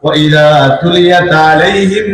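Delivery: slow, chanted Arabic verse; Quran recitation. Acted. Preacher closing with a recited verse, chanted. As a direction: intone it melodically, drawing out words.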